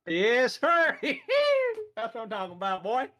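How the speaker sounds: high, loud laughing yes sir; whooping cheer. Acted. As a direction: holler it like a rowdy good old boy. Player cheering in character.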